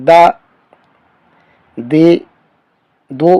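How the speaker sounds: دا، دے، دو said thin and clipped short; light broken daal. Shown as a demonstration. Teacher contrasting daal with dhaad, light thin syllables. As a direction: keep each d light and cut it off. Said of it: "دال میں تھوڑی سی آواز break ہوگی".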